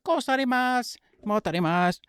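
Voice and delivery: high, loud mock Japanese fighter voice; imitating Tatsuro. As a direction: squeak it out like a sweet, innocent fighter. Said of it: "he talk like this".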